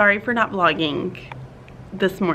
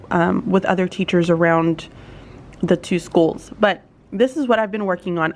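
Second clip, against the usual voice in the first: usual speaking voice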